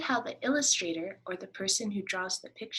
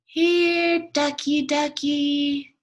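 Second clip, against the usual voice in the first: high, loud sing-song call; 7 semitones higher, 11 dB louder, much slower than usual. Acted. Duck calling a duck to come, high and loud.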